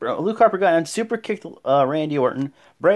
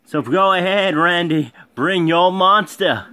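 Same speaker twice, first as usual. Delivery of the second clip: louder, slow mock taunt; taunting challenge; 4 semitones higher, 5 dB louder than usual. Bray Wyatt daring Randy to bring his monster, loud taunt. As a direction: dare him to bring it on. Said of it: "and he says".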